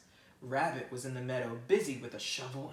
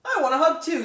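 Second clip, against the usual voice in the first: high, much louder bird; eager bird; 14 semitones higher, 15 dB louder than usual. Acted. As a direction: jump in wanting a hug. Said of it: "said Bird".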